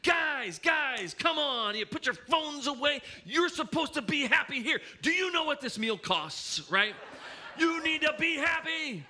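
dad begging the family to enjoy dinner; exasperated dad. Retold or imitated.

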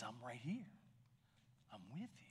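whispered comfort. Acted. Jesus to grieving Martha, whispering comfort. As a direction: whisper it as if holding someone.

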